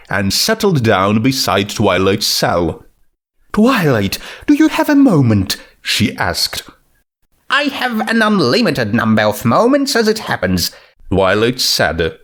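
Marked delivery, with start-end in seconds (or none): 3.5-5.6 Rarity voice. Acted. 7.5-10.7 Twilight voice. Acted.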